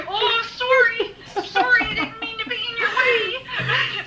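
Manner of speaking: put-on high, slow, drawn-out Minnesota accent; over-apologize in a high Minnesota lilt, laughing; Julie Dorsers. Julie apologizing after her toe is stepped on, high Minnesota accent. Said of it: "and she would say".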